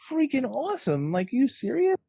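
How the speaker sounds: high, gushing praise; gushing fan. Retold or imitated. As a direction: rave like an excited fan. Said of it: "I'm like".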